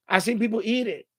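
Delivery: normal talking voice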